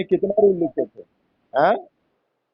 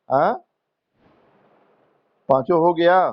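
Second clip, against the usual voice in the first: normal talking voice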